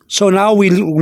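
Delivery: ordinary delivery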